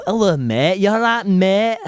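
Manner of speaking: cheery mock Hull accent greeting. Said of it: "Just like the accent".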